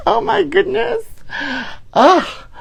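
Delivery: very high squeal breaking into laughter; laughing squeal. Real, not acted. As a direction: shriek it through helpless giggles. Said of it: "I can't stop laughing".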